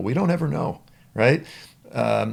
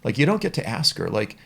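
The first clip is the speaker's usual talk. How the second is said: ordinary talk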